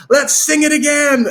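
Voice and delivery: high, loud eager cheer; excited. Retold or imitated. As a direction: pitch it up high and loud, gleefully keen. Bandmate eager to sing again.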